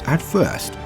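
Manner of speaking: ordinary delivery, nothing marked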